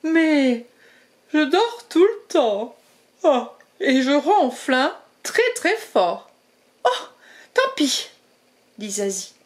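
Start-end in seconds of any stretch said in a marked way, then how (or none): 0.0-6.3 sleepy badger. Badger speaking. 6.8-8.1 high, light mouse shrug; shrugging little mouse. Acted. Zazie speaking.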